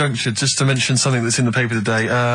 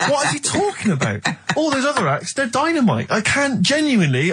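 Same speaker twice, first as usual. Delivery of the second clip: high-pitched comic outrage; incredulous; 10 semitones higher than usual. Real, not acted. Presenter reacting to newspaper praise of the show, incredulous. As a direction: protest in high, baffled disbelief.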